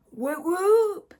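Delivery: high, loud cheer; celebratory whoop. Real, not acted. Cheers own Vlogmas announcement.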